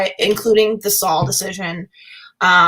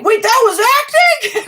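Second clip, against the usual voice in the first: much higher and louder, incredulous; imitating stunned Kevin Smith; 14 semitones higher, 6 dB louder than usual. Retelling Kevin Smith's stunned reaction to the fight scene, high and incredulous. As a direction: blurt it like you just can't believe it. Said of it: "he was like".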